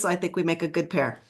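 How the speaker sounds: usual speaking voice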